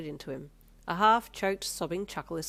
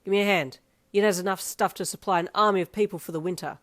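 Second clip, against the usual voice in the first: loud teasing call; 9 dB louder than usual. A narrator's reading. Dad calling teasingly.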